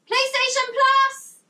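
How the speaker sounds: high, loud, stretched cheer; excited. Real, not acted. Streamer finding a PlayStation gift, thrilled shout. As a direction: shout it out in real delight.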